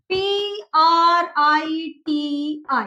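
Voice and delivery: पी आर आई टी आई spelled out very slowly, letter by letter; slow spelling. Shown as a demonstration. Teacher spelling Priti for students, very slowly letter by letter. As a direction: drag each letter of पी आर आई टी आई apart. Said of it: "एकदम clear है".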